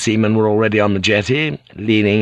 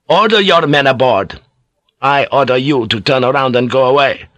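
higher, raised voice of authority; insistent cleric pulling rank; forceful command; 4 semitones higher, 4 dB louder than usual. Acted. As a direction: order the officer off firmly. Acted quarrel over authority.

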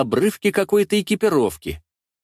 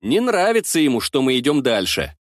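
usual speaking voice

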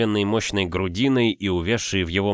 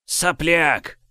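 contemptuous; 7 semitones higher than usual. Acted. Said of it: "презрительно рассмеялся он".

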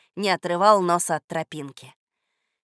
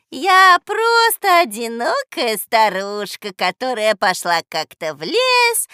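slow, loud, swooping sing-song; feigned-innocent old woman; 8 dB louder than usual. A narrator's reading. Old woman denying she is a witch, crooning. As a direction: plead innocence in a syrupy croon.

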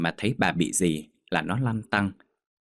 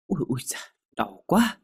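high, wincing moan; acted ouch, pitched high; in pain; 6 semitones higher than usual. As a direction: wince through a sore spot. Hurt mother groans in pain.

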